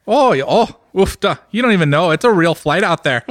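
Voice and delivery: a light Minnesota-Scandinavian lilt on the vowels; Minnesota accent. Pretend. Friend riffing on a Fargo trip in a light Minnesota accent. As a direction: play a folksy Upper Midwest local. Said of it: "Canadian accent with a little bit of Scandinavian".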